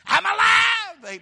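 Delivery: high, very loud, long-held cry; triumphant shout. Retold or imitated. Preacher voicing risen Jesus to Mary, triumphant shout. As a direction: shout it out, stretching the last word.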